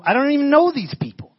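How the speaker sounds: high, loud burst of amazement; overwhelmed disbelief. Retold or imitated. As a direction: recall being stunned, voice jumping up. Reliving his overwhelmed reaction to the rescue.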